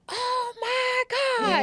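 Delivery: high, slow, drawn-out cry; overwhelmed relief. Retold or imitated. Told she can live with Portia, a high drawn-out cry. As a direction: stretch it out in stunned relief. Hearing she has a place to stay.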